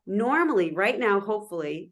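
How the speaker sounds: ordinary delivery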